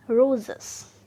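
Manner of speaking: Roses with the RO loud and long; stress on first syllable. Shown as a demonstration. Teacher demonstrating stress on roses' first syllable. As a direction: lean hard on RO in roses. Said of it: "first syllable पर हमने trace किया".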